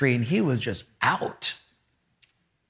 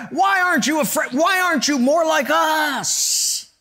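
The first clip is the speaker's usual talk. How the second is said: panicked disciples; 8 semitones higher than usual. Acted. Disciples in the storm crying out to Jesus, panicked shouting. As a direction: plead in frantic alarm at a sleeping teacher.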